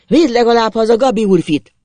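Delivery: loud, high pleading call; full-throated shout. A narrator's reading. Old man pleading for young master's rescue, full-throated shout. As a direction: yell the plea after the rider. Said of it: "harsogta torka szakadtából az öreg".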